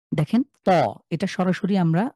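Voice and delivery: ordinary talk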